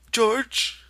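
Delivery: put-on high, loud, pleading childlike voice; Lenny begging. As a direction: beg him not to leave. Lenny calling.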